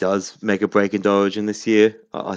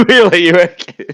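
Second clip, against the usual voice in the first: laughing; 10 semitones higher, 8 dB louder than usual. Real, not acted. Laughing disbelief at hot tip.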